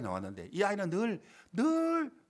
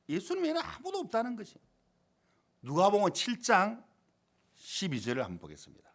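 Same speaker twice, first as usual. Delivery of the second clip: ordinary talk, nothing marked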